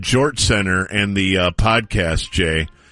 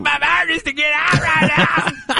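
mock yell. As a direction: yell it out like a panicked drunk. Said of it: "he's just screaming at everybody".

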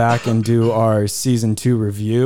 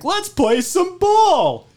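very high, drawn-out scream; imitated scream; much slower than usual. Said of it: "when he screams at Frank".

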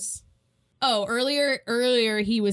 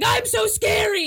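much higher, louder hammed-up monster boast; mock monster; 12 semitones higher, 5 dB louder than usual. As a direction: ham it up as a cartoon monster. Voicing the boogeyman.

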